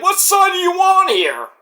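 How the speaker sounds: high, loud demanding shout; hammed-up argument yell; mock shout. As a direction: yell the question at him. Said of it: "It's like".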